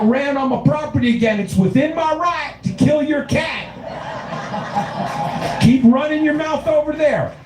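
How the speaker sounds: high-pitched, strained yelling tirade; angry property owner. Acted. Property owner threatening a neighbor over a cat, angry high-pitched yelling. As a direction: holler threats at the top of your register.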